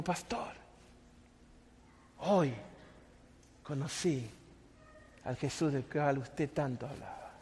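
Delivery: low, hushed, halting with long pauses; moved confession. Retold or imitated. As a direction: confide it softly, word by word. Retelling Dani's moved confession. (confesión conmovida, en voz baja)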